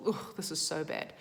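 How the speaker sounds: low, soft, disgusted "Ugh, this is so bad"; mock despair, dropped low and soft; disgusted groan. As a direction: groan it out like it's all ruined. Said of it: "that skew it into".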